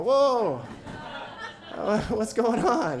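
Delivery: high, loud startled whoa then slow laughing question; hammed-up startled husband; mock surprise. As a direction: act caught off guard, then slow it down. Acted surprise when wife stops.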